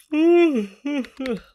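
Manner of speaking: big high burst of laughter; loud laugh. Real, not acted. Laughs after apology.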